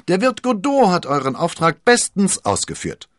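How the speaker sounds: loud, high-pitched exclamation; calling out. A narrator's reading. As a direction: call it out brightly to the friends. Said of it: "rief D'Artagnan".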